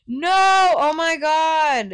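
high, loud, drawn-out wail; alarmed shout. Real, not acted. Player caught out in the game, alarmed shout. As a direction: cry out loud and high, stretching it.

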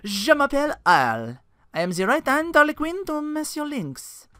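put-on French accent. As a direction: introduce yourself like a smug French jester. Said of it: "French accent".

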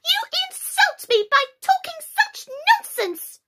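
slow, very high indignant squeak; offended. A narrator's reading. Mouse walking away from Alice, deeply offended. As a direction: rebuke loudly, each word piercing.

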